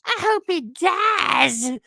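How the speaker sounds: very high mock-outraged squeal; hammed-up high-pitched outrage; mocking detractors. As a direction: imitate an indignant critic, loud and high. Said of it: "They're like".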